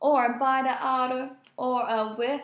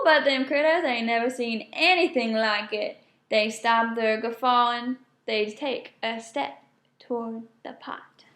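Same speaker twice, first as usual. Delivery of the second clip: plain reading voice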